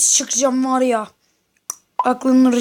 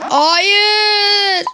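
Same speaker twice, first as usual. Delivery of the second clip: anguished shout; 4 semitones higher than usual. Real, not acted. Losing in game, crying no.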